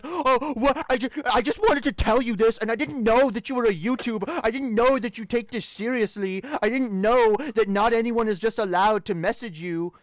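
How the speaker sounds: lightly voiced whining troll; mock troll whine. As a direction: play the troll's whiny excuses. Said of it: "Be like".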